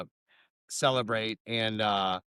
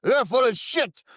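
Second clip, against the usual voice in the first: loud, high imitation of an angry drinker; mock-angry outburst, pitched up; heated Irishman; 11 semitones higher, 7 dB louder than usual. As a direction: shout the accusation as a retold bar challenge. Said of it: "it was getting a little heated".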